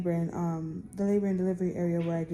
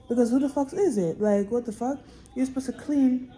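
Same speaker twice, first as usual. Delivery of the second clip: louder and higher, exasperated; exasperated outburst; 5 semitones higher, 5 dB louder than usual. Real, not acted. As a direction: demand an answer, fed up. Angry rant about dirty bathroom.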